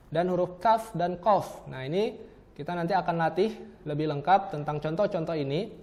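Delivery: ordinary talk, nothing marked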